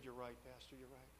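very soft, chastened concession; subdued admission. Retold or imitated. Student conceding to his pastor, very subdued. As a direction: give in almost under your breath.

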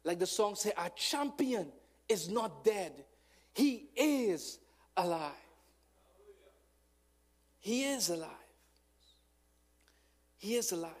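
loud, high, rising sermon cry; full-force preaching. Real, not acted. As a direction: preach it hard to the crowd.

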